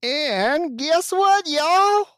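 slow, stretched Southern y'all, rising high; mock Southern drawl. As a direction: drawl it like a country host. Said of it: "speak as well Southern".

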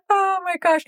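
high voice, weak from laughing; laughed-out. Real, not acted. Recovering from the slang joke, laughed-out. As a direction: catch your breath after a laughing fit.

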